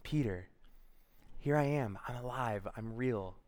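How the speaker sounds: soft, unhurried, tender question; gentle Jesus. Acted. Risen Jesus asking Peter for his love, gently. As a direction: speak quietly and let each phrase rest. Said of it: "and Jesus asks him".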